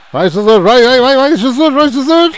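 building high, loud urging; cheering shout. Real, not acted. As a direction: drive him on. Cheering a run. (incentivo crescente)

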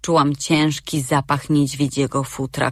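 ordinary delivery, nothing marked

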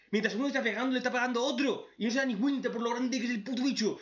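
hammed-up frustrated battle advice; mock impatient lecture. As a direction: bark tactics at clueless fighters. Lecturing characters how to fight.

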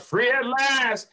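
echoing the famous ending of I Have a Dream; Dr King's Free at last. Retold or imitated. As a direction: soar on it like King at the climax of the speech. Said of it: "getting to that part".

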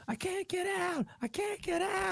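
high sung-scream demo of the hook; high shouted vocal line. Shown as a demonstration. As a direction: show him how to scream it. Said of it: "just go".

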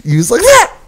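very high, loud shriek; girly scream. Retold or imitated. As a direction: shriek like a scared little girl. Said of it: "screamed like a little girl".

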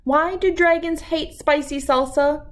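ordinary delivery

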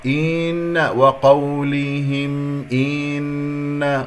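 إن drawn into a long chanted een; faulty, over-long vowel on إن; wrong lengthened kasra. Shown as a demonstration. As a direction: stretch the i of إن into a y. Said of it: "خطأ".

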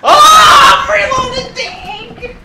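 very high loud yell; startled shout. Real, not acted. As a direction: yelp in sudden surprise. Sudden shout in game.

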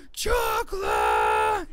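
long drawn-out loud scream; crazed cartoon roar, stretched out; SpongeBob chocolate yell. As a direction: bellow it slowly like a cartoon maniac. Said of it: "you know, SpongeBob".

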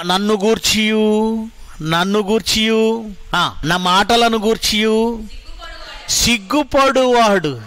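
slow, measured recitation; emphatic verse reading. Acted. Preacher reading the verse aloud. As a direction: lean on every word.

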